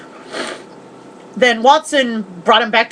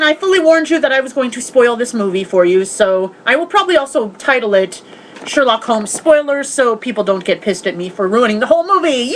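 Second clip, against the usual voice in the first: normal talking voice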